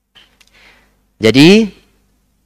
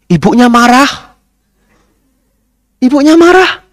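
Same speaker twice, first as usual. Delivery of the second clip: high, hammed-up exclamation; mock alarm; 13 semitones higher, 4 dB louder than usual. Monk tells the crowd the lady got angry, in mock alarm. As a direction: play up the shock for the audience.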